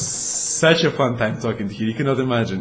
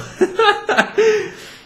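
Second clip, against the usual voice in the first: big high laugh; 3 dB louder than usual. Real, not acted. Laughing at his own joke to viewers, big high laugh. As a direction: burst of high-pitched laughter.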